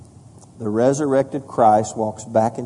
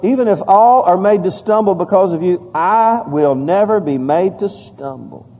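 loud, high preacher's reading of scripture; preaching scripture; 6 semitones higher, 4 dB louder than usual. Acted. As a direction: proclaim the verses to the whole church.

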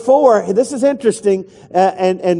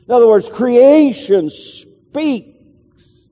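higher, slow, each word weighted; slow emphatic explanation; 5 semitones higher, much slower than usual. Shown as a demonstration. Preacher explaining the psalm to congregation, slow emphasis. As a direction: drive the meaning home slowly. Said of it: "preacher explaining the psalm".

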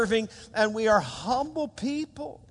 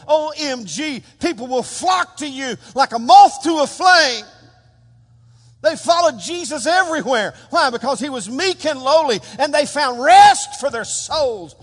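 loud, rising preacher's shout; full-force revival preaching, much louder; fiery preaching; 6 semitones higher, 8 dB louder than usual. Acted. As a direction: preach it to the back row, building.

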